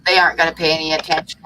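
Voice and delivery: ordinary talk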